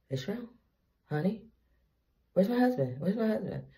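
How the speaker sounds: high, loud, dazed calling; woozy mock panic; calling out groggily. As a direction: call for your husband waking from anesthesia. Groggy after anesthesia calling husband.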